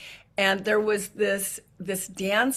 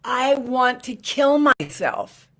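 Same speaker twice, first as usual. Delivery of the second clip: loud, high, slow yell; 6 semitones higher, 6 dB louder, slower than usual. Retold or imitated. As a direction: shout it out across the room. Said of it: "he yells".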